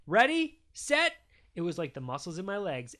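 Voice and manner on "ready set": high, loud race-start call; shouting. A narrator's reading. Daddy starting his kid's sprint, loud shouting. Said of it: "When Daddy shouted".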